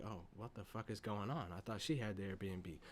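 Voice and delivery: low, soft, confused murmur; hushed viewer reaction. Retold or imitated. Viewer wondering why Keith answers, low murmur. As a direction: say it under your breath, puzzled. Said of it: "and you're like".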